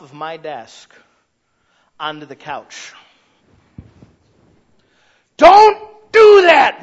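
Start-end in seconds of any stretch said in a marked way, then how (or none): 5.4-6.8 very high, very loud, slow frightened yell; startled yell. Retold or imitated. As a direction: scream it out in sudden fear. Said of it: "makes our voices hit the top of the roof".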